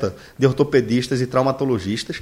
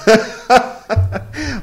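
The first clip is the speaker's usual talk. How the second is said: 1 laugh; 9 semitones higher than usual. Real, not acted. Laughs at the joke.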